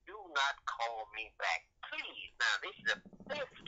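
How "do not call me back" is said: normal talking voice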